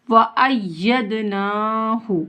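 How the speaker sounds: व अय्यदनाहु slow, holding the doubled y; firm tashdeed. Shown as a demonstration. Teacher showing the tashdeed, held double y in व अय्यदनाहु. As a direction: lean on the "yy" in व अय्यदनाहु. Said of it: "इसको जमा कर पढ़ेंगे".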